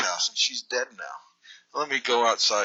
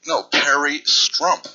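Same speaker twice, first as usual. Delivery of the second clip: slow, louder, drawn-out insistence; 3 semitones higher, 5 dB louder, much slower than usual. Pretend. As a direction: stretch the name out stubbornly. Caller insists slowly.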